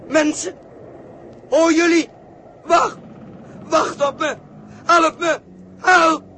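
high, loud, breaking shouts; desperate cry for help. Acted. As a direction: yell after the passing ship. Joost roept schip om hulp. (Joost schreeuwt wanhopig om hulp naar het schip)

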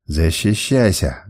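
shouted command. Acted. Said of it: "крикнул я".

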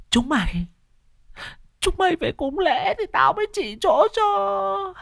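very high, eerie drawn-out spirit voice; shrill haunting spirit demanding offerings; possessed ghost voice. Acted. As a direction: wail it like a river ghost inside a woman. Possessed woman speaks as ghost.

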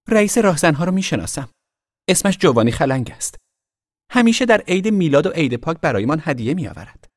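put-on high, loud offhand girl; dismissive girl. A narrator's reading. Girl telling the boy she knows the real bandit chief. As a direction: shrug it off.

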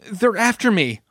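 high-pitched urgent plea; begging. A narrator's reading. Girl tells officer she is being pursued, pleading. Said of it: "Judith begged".

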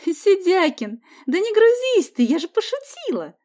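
put-on high, teasing girl's reassurance; amused. A narrator's reading. Sonya tells boy she was joking, amused. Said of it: "засмеялась Соня".

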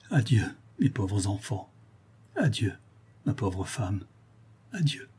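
hushed, broken-up goodbye with pauses; softly grieving, as if through tears; heartbroken low farewell. A narrator's reading. Weeping father bids his family farewell, heartbroken and hushed. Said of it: "dit tout bas, d'une voix déchirante, en pleurant".

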